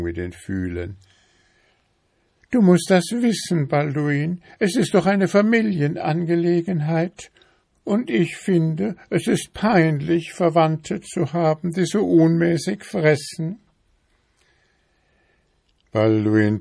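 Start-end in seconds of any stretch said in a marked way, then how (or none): 2.5-13.6 high-pitched, fussy wife voice, louder; nagging wife beetle. A narrator's reading. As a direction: pester him in a high, insistent voice. Wife lectures husband.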